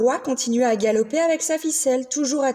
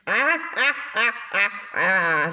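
mock sneer laugh; 3 semitones lower than usual. A narrator's reading. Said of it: "ricana le monstre au loin".

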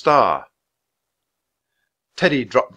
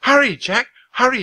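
much higher, louder urgent call; 12 semitones higher, 5 dB louder than usual. Acted. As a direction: Annie urges Jack to hurry. Annie urging from the ledge.

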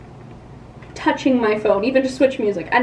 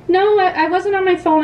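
protesting; 7 semitones higher than usual. Retold or imitated. Arguing with the cop.

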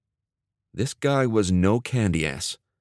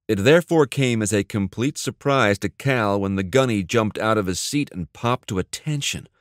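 ordinary delivery

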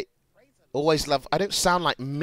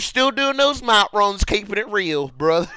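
imitation of a chummy superfan, pitched up; wholesome fan; 4 semitones higher than usual. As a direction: greet your idol as an eager fan. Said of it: "They are wholesome".

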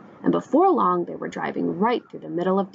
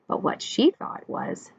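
ordinary delivery

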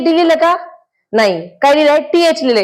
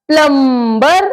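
Plumber stretched slowly, each sound drawn out; slow drawn-out demo; much slower than usual. Shown as a demonstration. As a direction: say plumber slowly for the class to copy. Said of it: "teacher repeats the word slowly". (Plumber हळू, ओढून उच्चार)

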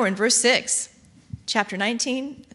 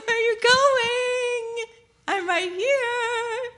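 high, drawn-out sobbing plea; whiny abandoned bride; slower than usual. Retold or imitated. Bride calling after her running groom, high slow mock-crying wail. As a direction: wail it like a left-behind bride, slowly. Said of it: "You're like".

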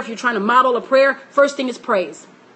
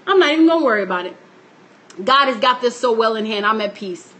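serene calm; 5 semitones lower, faster than usual. Retold or imitated. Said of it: "lay there and say".

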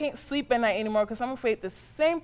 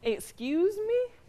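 slow, high, rising "excuse me"; affronted disbelief; 9 semitones higher, much slower than usual. Acted. Mother pulling up her son for backtalk, affronted. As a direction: draw it out, pitch climbing in indignation.

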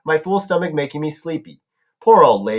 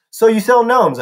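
feigned interest; 6 semitones higher, 5 dB louder than usual. A narrator's reading. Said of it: "trying to sound interested".

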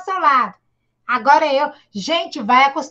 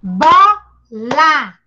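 syllables clapped; 5 dB louder than usual. Shown as a demonstration. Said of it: "Quantas sílabas tem essa palavra bola?".

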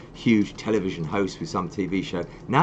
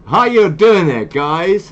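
hammed-up Southern American, higher, louder and drawn out; bad Southern American accent; 8 semitones higher, 8 dB louder, much slower than usual. Shown as a demonstration. Host failing a requested accent on air, hammed-up Southern American accent. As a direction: attempt a Southern American accent loudly and badly. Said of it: "can [name] do a good Southern American accent?".

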